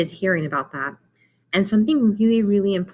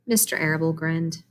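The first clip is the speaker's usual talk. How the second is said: usual speaking voice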